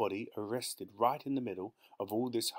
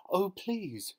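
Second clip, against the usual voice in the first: high, anxious plea; pleading cry; 10 semitones higher than usual. A narrator's reading. Wife begging husband not to delay, pleading cry. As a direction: beg him not to go. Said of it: "she cried".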